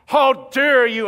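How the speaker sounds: mock-offended churchgoer, loud and slow; indignant outrage. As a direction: throw out an offended HOW DARE YOU. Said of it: "you're sitting there saying".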